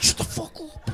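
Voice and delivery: high, soft mock outburst; acted-out exasperated whisper; hissed whisper. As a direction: hiss it at the baby under the breath. Replays message to the baby.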